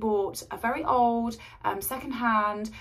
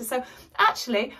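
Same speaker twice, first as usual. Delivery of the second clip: ordinary talk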